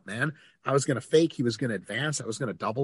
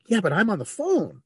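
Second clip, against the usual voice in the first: higher-pitched, exasperated brush-off; testy protest; 7 semitones higher, 4 dB louder than usual. Retold or imitated. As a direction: insist you're busy, a little testy. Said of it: "And I said it just like that".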